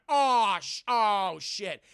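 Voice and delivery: loud mock alarm, easing off; comic burst of dismay; shocked exclamation. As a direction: imitate being caught out, loud first. Retold shock at gunpoint.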